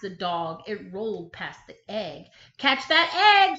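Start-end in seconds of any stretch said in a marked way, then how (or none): 2.6-3.6 loud, high urgent call; sheep shouting. Acted. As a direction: shout after the rolling egg. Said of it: "sheep said".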